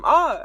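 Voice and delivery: high loud excited scream from outside; kids screaming. Retold or imitated. Kids outside scream during hide and seek, high shriek. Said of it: "I hear the kids scream".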